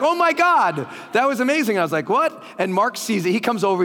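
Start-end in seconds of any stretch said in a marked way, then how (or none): 0.0-1.8 high, loud, thrilled gush; a high, loud rave from the crowd; gushing excitement. Retold or imitated. Excited audience retold. 2.1-2.4 surprised. Retold or imitated. Baffled reply retold.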